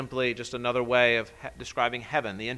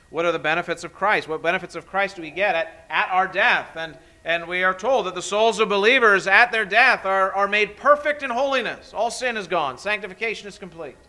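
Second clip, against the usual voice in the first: 1 preaching; 7 semitones higher, 10 dB louder than usual. Acted. Preaches catechism answer.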